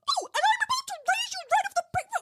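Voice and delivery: very high, very fast diva squeal; high excited diva gabble; fast Miss Piggy. Retold or imitated. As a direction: rush it out in a high pig voice. Piggy line, rushed.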